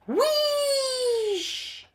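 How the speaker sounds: very high long whoosh; whoosh of steam. A narrator's reading. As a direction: let the steam rush out. Steam bursting out.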